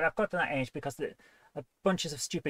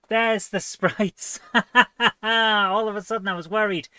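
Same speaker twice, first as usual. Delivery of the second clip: loud, higher, real relieved laughter; laughing with relief; 6 semitones higher, 9 dB louder than usual. Real, not acted. Streamer who was worried about lost sprites, laughing in relief. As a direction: burst out laughing as the worry lifts.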